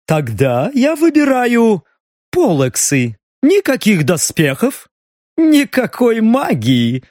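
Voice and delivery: slow, high, smirking taunt; cynical smirk. A narrator's reading. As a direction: smirk through every word. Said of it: "цинично ухмыльнулся Губерт".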